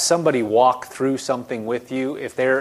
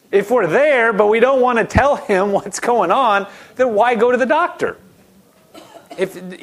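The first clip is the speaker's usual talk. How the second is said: raised, louder preaching voice, pressing hard; forceful preaching; 6 semitones higher, 3 dB louder than usual. Acted. Pastor pressing the congregation, forceful preaching. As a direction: drive the point home from the pulpit.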